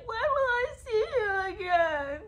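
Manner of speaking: high-pitched hammed-up sobbing; mock crying. Podcaster imitating a girlfriend at a parting, mock crying. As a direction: wail it as a fake tearful plea. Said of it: "And she's crying".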